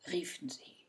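usual speaking voice

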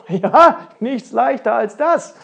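loud, high cocky reply; booming ready agreement; confident exclamation. Acted. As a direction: belt it out, sure of yourself. Tall fellow answers with confidence.

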